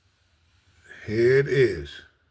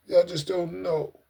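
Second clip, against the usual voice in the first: ordinary talk, nothing marked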